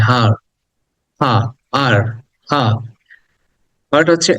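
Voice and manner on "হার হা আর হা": হার split into হা and আর to show the আ sound; teacher breaks a word into sounds; breaking down হার. Shown as a demonstration. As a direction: pull হার apart slowly, হা then আর. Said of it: "দেখো হার উচ্চারণ করলে মাঝখানে একটা আ আসে".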